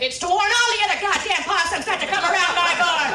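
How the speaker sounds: loud, high holler; mock irate tirade; imitated grandma ranting. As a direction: yell the warning as an ornery grandma. Grandma's angry justification.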